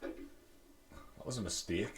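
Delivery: dry whispered aside. Real, not acted. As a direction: breathe it out deadpan. Dry aside after friend's story.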